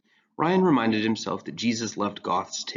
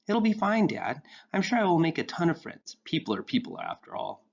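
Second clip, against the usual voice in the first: put-on higher, eager young voice; earnest teen boy; 8 semitones higher than usual. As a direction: play Ryan bright and earnest.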